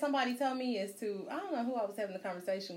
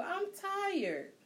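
put-on whiny teen; 11 semitones higher than usual. Daughter crying about getting up.

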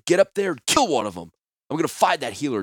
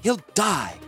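high, urgent cry; desperate plea; 9 semitones higher than usual. A narrator's reading. Healer pleading for the bleeding guard, desperate cry. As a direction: plead for the wounded man's life.

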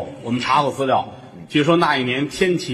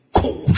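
breathy imitated crash noise; mock birth crash sound. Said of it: "你母亲一使劲". (模仿咔嚓声)

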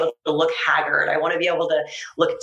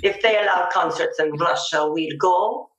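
mock Russian accent, heavy and deadpan. Shown as a demonstration. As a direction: play up a comic Russian accent. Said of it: "And then you wanted Russian".

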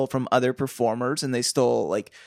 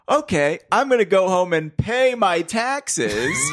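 higher, slow, over-announced; speaking for a hidden microphone; 5 semitones higher, slower than usual. Acted. Fenton Hardy declares his innocence to a bugged room, stagy announcement. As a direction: announce it pointedly for eavesdroppers. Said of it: "if there's a microphone somewhere".

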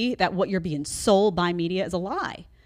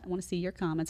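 usual speaking voice

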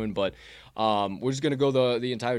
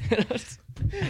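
laugh. Real, not acted. Laughing at the memory.

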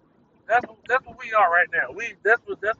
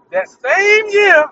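much higher and louder, very slow; stretched emphasis; 13 semitones higher, 12 dB louder, much slower than usual. Real, not acted. Fan recalling Kobe, stretched high and loud. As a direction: draw it out hard.

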